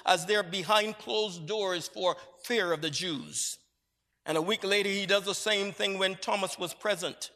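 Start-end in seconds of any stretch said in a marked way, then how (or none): none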